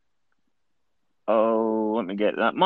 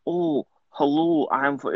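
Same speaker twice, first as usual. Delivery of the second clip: exaggeratedly slow, slightly raised Scots; slowed-down accent; 3 semitones higher, slower than usual. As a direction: imitate an expat slowing the accent right down. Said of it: "their accent slows down".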